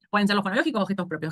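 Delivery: ordinary delivery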